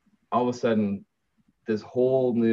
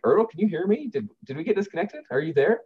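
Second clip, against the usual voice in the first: higher, questioning call down the line; raised-pitch, concerned phone questions; anxious checking; 8 semitones higher, faster than usual. Retold or imitated. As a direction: check if the caller is still there.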